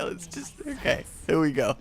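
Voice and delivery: ordinary talk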